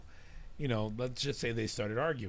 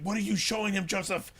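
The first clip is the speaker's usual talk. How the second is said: high, louder mock accusation; retold scolding mom; 9 semitones higher, 6 dB louder than usual. Mom turning on dad over what he showed their kid, mock scolding. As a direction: act out an exasperated wife.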